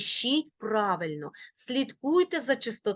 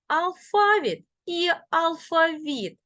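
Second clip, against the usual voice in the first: алфавіт stressed on фа, then on віт; double stress; 7 semitones higher, 3 dB louder than usual. Shown as a demonstration. Teacher showing both allowed stresses, double stress. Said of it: "можуть наголошуватися бінарно".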